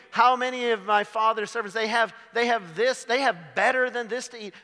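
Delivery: the son in the pig pen realizing his father's servants eat better; excited realization. Acted. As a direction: let it dawn on him with rising urgency. Prodigal's realization voiced.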